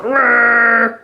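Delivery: growl. Pretend. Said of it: "And he's going".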